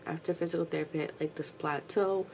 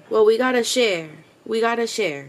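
loud, higher scolding of the dogs; 4 semitones higher, 12 dB louder than usual. Real, not acted. Owner scolds her dogs to share, loud. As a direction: insist firmly that they share.

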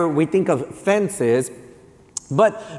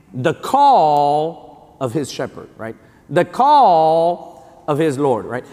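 slow, weighty preaching; much slower than usual. Acted. Pastor drawing out the dog's lesson slowly. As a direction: stretch each word out slowly.

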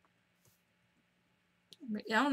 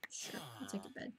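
sneaky soft whisper; hushed sneaky aside while stealing; 16 dB quieter, faster than usual. Real, not acted. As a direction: whisper it like a thief.

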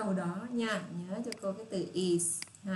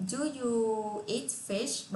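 slow model question; 3 semitones higher, much slower than usual. Shown as a demonstration. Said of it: "Rồi".

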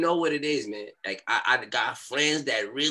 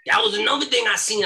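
ordinary talk, nothing marked

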